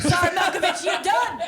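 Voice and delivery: loud, high, laughing call-out; mock shout. Cohost mock-shouts a goodbye to the cut actor, laughing. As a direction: shout it out as a joke farewell.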